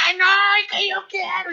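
put-on high, squealing kid's wail; whiny child tantrum. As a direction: whine it like a spoiled toddler. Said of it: "Y empezó a gritar". (niño berrinchudo chillando agudo)

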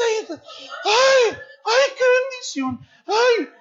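hammed-up rapture of the old lady; imitating a shrieking blessed old woman; mock ecstatic cry.

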